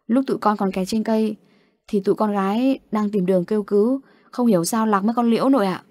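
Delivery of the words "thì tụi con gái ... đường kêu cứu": ordinary delivery, nothing marked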